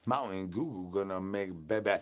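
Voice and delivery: ordinary talk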